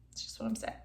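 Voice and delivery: breathy whisper, repeating the point. Real, not acted. Vlogger whispering her point again to viewers, whisper. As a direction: whisper it as a sly afterthought.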